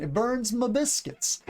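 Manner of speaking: slow, high Southern "burns ma biscuits"; Southern accent. Acted. Teacher showing a very Southern idiom, slow Southern accent. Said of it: "This is very Southern".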